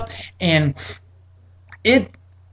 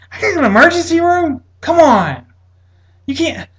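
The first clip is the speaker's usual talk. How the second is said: much higher, loud exasperated protest; incredulous protest; 11 semitones higher, 3 dB louder than usual. Retold or imitated. To the doctor sending him to the ER, high incredulous protest. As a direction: protest in disbelief.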